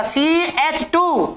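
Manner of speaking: drawn-out board dictation; slow dictation. Real, not acted. Teacher writes out the formula for students, slow dictation. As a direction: stretch each part for note-takers.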